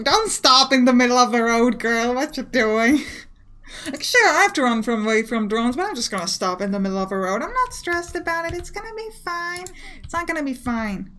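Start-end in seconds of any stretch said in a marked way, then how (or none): 0.0-3.0 loud, exasperated outburst at a game character; raised-voice disbelief; exasperated scolding. Real, not acted. Scolds game character stopped in road. 7.4-9.7 high-pitched pretend calm; unconvincing self-reassurance in a raised pitch; mock reassurance. Feigns calm under game stress.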